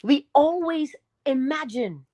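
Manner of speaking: ordinary talk, nothing marked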